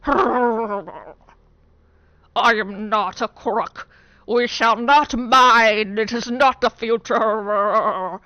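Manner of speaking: put-on slow, loud Nixon with a British accent; slow, pompous mock Nixon; Nixon impression. Pufferfish leader as British Nixon, slow and loud. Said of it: "He sounds like Nixon with a British accent".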